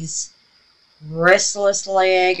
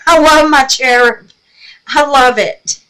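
higher and louder, beaming with joy; gushing delight; 5 semitones higher, 9 dB louder than usual. Real, not acted. Crafter gushing to viewers about her cherub, delighted. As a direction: gush over the cherub.

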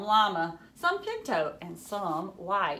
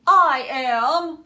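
determined; 7 semitones higher, 13 dB louder than usual. A narrator's reading. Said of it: "thought Toast".

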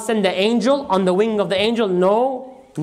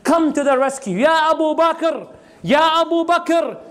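wołanie o pomoc; 6 semitones higher than usual. Retold or imitated. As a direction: call out urgently for a friend, retold. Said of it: "People yelled out loud".

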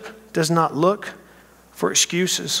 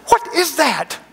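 startled question; 12 semitones higher, 4 dB louder than usual. Acted. Woken by strange noise outside.

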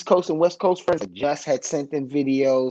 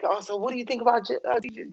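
higher, quicker curious asking; curious question; 8 semitones higher, 5 dB quieter, faster than usual. Retold or imitated. Bosses after rehearsal asking about the DJ, higher and fast. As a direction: lift the voice into their eager question.

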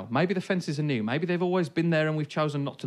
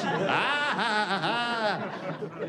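high bursts of laughter fading out; laughing; 5 semitones higher, much slower than usual. Real, not acted. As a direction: laugh helplessly at the memory. Laughing at the ghostly photo.

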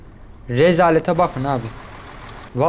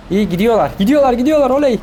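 cheering; 6 semitones higher, 4 dB louder, faster than usual. Real, not acted. Cheering the trucks on.

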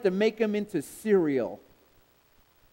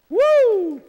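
high loud preacher whoop; 11 dB louder than usual. Real, not acted. Preacher whooping to the congregation, loud and high. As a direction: let out a joyful whoop.

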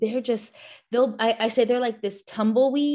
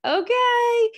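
very high, louder sing-song okay; amused okay; 7 dB louder than usual. Retold or imitated. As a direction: humour them, yes I know. Scan shows lady bits again.

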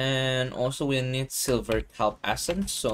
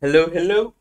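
cartoonish greeting; 6 semitones higher, 9 dB louder than usual. Streamer greeting viewers.

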